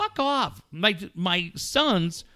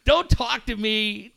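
normal talking voice

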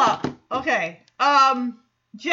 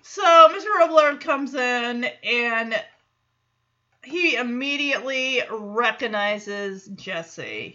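ordinary talk, nothing marked

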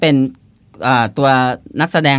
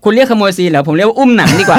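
higher-pitched, breaking into laughter; amused; 6 semitones higher, 4 dB louder than usual. Real, not acted. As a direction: top the other's remark with a laugh. Joking one-up on scene stealer.

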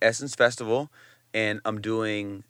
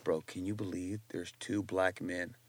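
hushed aside; 5 semitones lower, 13 dB quieter than usual. Retold or imitated. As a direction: lean in and say it low. Whispered aside, cameras off.

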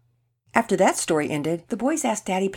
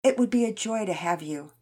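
earnest plea; 5 semitones higher than usual. Acted. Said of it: "she spoke up".

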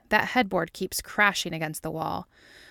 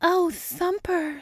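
very high, drawn-out put-on voice; hammed-up ecstasy; 11 semitones higher, much slower than usual. A narrator's reading. Dana answering Mulder in bed, ecstatic. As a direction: coo it slowly up high.

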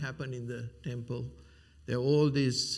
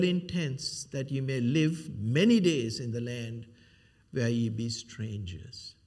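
solemn scripture reading; 4 dB louder than usual. Acted. Reading scripture aloud with weight.

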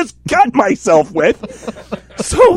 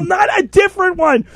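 high, raised correction; exasperated shout; 10 semitones higher than usual. Retold or imitated. Teller corrects his partner with an exasperated shout. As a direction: bark the mistake back at her.

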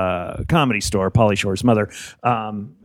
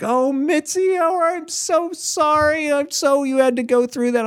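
much higher, syrupy slow sympathy; fawning staff; slower than usual. Retold or imitated. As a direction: gush over her like sucking up. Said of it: "they were just like".

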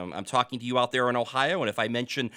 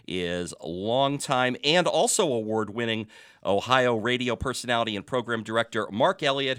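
ordinary talk

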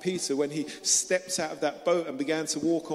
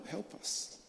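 faint breathy plea; 13 dB quieter than usual. Retold or imitated. Disciples crying out for help.

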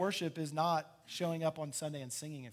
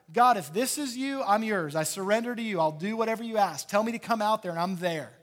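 loud, urgent declaration of commitment; eager surrender; 5 semitones higher, 12 dB louder than usual. Acted. Peter pledges himself to God on the water, eagerly. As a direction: pour it out forcefully, all in.

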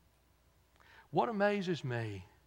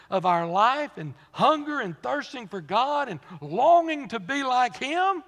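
louder and higher rising preaching cadence; 6 semitones higher, 7 dB louder than usual. Acted. Preacher recounting the Beatitudes, building loud and high. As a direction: build the list to a peak.

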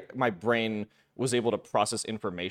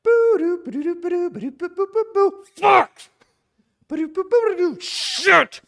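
very high, loud wailed game noises bursting into screamed swears; hammed-up kid's gaming tantrum; imitating screaming meltdown; 12 dB louder than usual. What parents heard from the other room, crying and screaming. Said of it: "I was crying and screaming".

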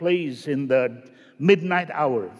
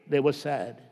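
lower, softer, sorrowful drop; sad; 7 semitones lower, 7 dB quieter than usual. Acted. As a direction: let it sink into sadness. Contrast after happy riders.

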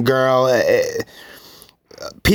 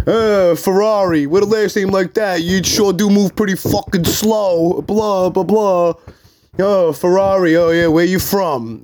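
mocking drill instructors; 8 semitones higher than usual. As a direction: sneer it out like a drill instructor ribbing a recruit. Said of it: "these asshole fucking".

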